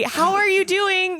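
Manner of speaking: high, drawn-out mock-friendly hello; chirpy greeting. Athlete re-enacts chatting to spectators mid-row, chirpy greeting. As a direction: greet everyone in a high, slow singsong.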